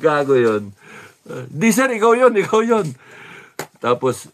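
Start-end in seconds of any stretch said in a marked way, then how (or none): 1.5-2.9 high, eager insisting; a fan insisting it really is him; insistent fan. Retold or imitated. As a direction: refuse to believe the denial. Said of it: "fans insisting".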